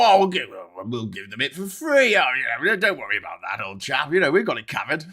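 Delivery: mock American that slips into British; British-sounding American accent. Americans reassuring Britain, mock accent that sounds very British. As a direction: attempt an American, landing on old-chap British. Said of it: "my American accent sounds more British than the British".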